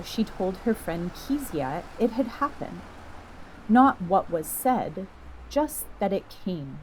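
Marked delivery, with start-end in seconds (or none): none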